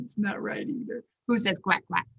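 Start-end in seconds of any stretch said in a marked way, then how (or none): none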